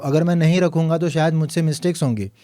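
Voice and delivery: usual speaking voice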